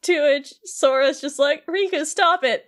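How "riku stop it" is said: pleading with a cracking voice. Retold or imitated. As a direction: voice breaks on the plea. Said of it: "his voice cracked when he was saying this".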